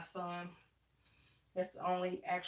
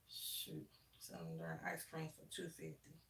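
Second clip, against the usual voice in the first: muttered aside, barely voiced; grumbled under the breath about the price; under her breath; 3 semitones lower, 14 dB quieter than usual. Real, not acted. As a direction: say it half to yourself, nearly whispering.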